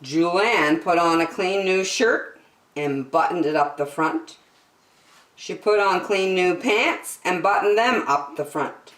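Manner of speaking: ordinary delivery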